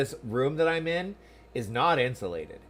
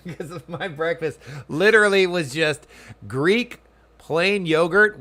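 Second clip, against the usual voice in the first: amused, a laugh breaking into the words; laughing while confessing; 5 semitones higher, 7 dB louder, slower than usual. Real, not acted. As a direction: chuckle through it, drawn-out and loud. Laughing at his own breakfast.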